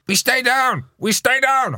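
high yelled call to the pack; shouted line call. Retold or imitated. Jumper yelling the call to his forwards, high shout. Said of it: "I couldn't do it in French".

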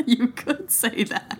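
chuckling as he repeats it; laughing echo. Real, not acted. Game master echoing the player's coy answer, laughing. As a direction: laugh through the words, amused.

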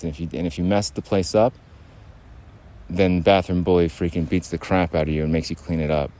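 usual speaking voice

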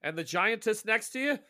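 high, loud watchman's query; guard's question. Acted. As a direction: ask it as the night guard sizing up visitors.

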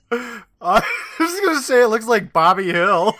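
loud, high words through real laughter; laughing hard. Real, not acted. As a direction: blurt it out while cracking up. Co-host cracking up at picture.

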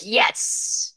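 a whispered mock cheer from her inner independent woman. Podcaster voicing her inner strong woman, a breathy mock yes. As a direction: breathe a tiny triumphant yes. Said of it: "The strong independent woman in me was like".

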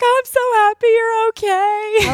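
high, sugary imitation; mock sweet voice. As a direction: gush like a relieved friend. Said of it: "she's over there like".

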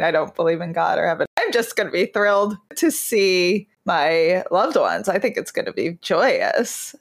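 ordinary talk, nothing marked

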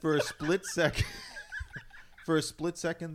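laughing while trying to talk; giggling through the words. Real, not acted. Laughing restart.

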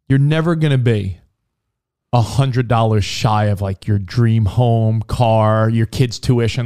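low unhurried voice. Retold or imitated. Quoting friend's saying.

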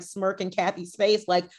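ordinary talk, nothing marked

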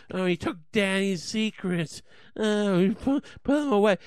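mock whine. Comic mocking State Department defenders, whiny. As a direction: sneer it like a crybaby. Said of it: "Don't fucking act like some".

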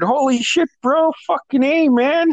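excited friend. Retold or imitated. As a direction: cheer him on like a stoked buddy. Friend shouting on seeing him.

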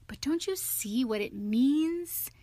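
impatient, rising disbelief; impatient cry. A narrator's reading. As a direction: spell it out to a dim friend, exasperated. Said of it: "cried the rat impatiently".

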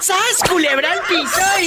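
high shout through laughter; laughing shout. Real, not acted. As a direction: yell it while still cracking up. Laughing exclamation after joke.

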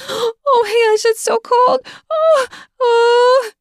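high, loud squealing at the cold; shocked squeals. Acted. Cold cream makes her squeal.

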